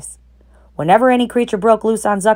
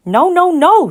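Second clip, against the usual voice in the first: very high loud no's; urgent protest; 12 semitones higher, 7 dB louder, slower than usual. A narrator's reading. Goose warning Wilbur off the pail, alarmed. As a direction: stop him fast. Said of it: "said the goose".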